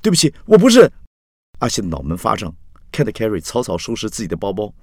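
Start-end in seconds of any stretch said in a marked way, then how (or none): 0.0-0.9 high, loud, hurried plea; urgent apology. A narrator's reading. Axi immediately apologizes to frightened Carrie, high and urgent. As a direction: blurt the apology out desperately. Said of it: "阿西立刻道歉说".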